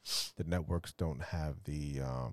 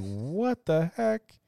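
drawn-out, high, loud disbelief; hammed-up retold shock, slow and high; mock astonishment; 11 semitones higher, 5 dB louder, much slower than usual. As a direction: stretch it out in exaggerated amazement. Retelling reaction to firework crater.

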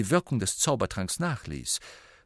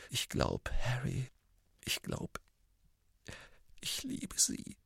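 choked, barely voiced confession; 2 semitones higher, 11 dB quieter than usual. A narrator's reading. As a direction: squeeze the words out, overcome. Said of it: "mit erstickter Stimme".

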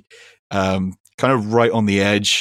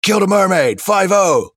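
mock yell, pitched way up and falling away at the end; imitated yell; 11 semitones higher, 5 dB louder than usual. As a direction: holler it like a dumb guy heckling a passing boat. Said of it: "he just yelling".

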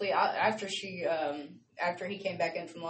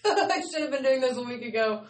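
laughing; 5 semitones higher, 5 dB louder than usual. Retold or imitated. Laughing at own procrastination.

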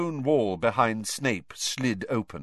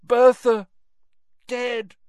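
high, strained, lost in his mind; delirious Crouch; raving old man; 8 dB louder, slower than usual. Acted. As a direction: call out the name, then fade.

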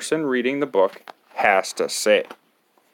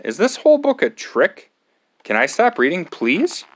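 high, pleading whine; 7 semitones higher than usual. Acted. Reader begging the kid to let him stop, high whine. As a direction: beg to stop, voice climbing high.

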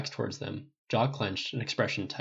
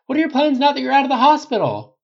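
high, loud press shout; urgent high-pitched calling; reporter calling out; 12 dB louder than usual. A narrator's reading. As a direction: shout to get his attention over a crowd. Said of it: "the reporter calls".

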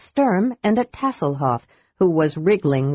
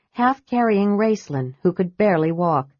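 ordinary delivery, nothing marked

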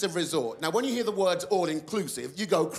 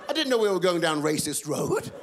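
ordinary talk, nothing marked

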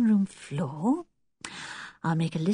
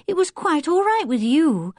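higher-pitched mild complaint; disappointed; 6 semitones higher, 3 dB louder than usual. A narrator's reading. Anne complaining the rabbit liked Wilfred, disappointed. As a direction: protest with a touch of hurt. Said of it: "said Anne, disappointed".

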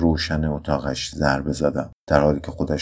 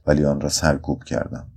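ordinary delivery

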